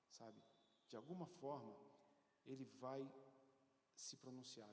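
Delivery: normal talking voice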